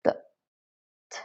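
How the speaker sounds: the and t said as short voiceless initials without a vowel; bare initials. Shown as a demonstration. Teacher demonstrates the true initial sounds for learners, short and clipped. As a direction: clip the and t to the bare consonant. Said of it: "they are pronounced as".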